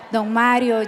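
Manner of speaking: normal talking voice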